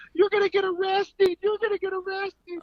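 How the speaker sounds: high squeaky mimic of the guy yelling; mocking the choked guy's high panicky threat; mock panicked yell. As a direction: whine it like a scared punk. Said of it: "He's like".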